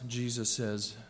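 usual speaking voice

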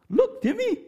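sudden high call of his name; a voice from above calling Timmy; God's voice. Retold or imitated. God voiced in testimony.

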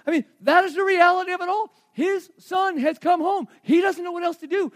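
loud, high shouted preaching; preaching at full force. Acted. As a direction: preach it out to the whole room.

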